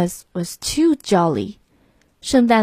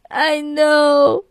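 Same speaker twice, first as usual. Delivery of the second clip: lamb voice; 5 semitones higher than usual. Acted. Lamb's voice in story.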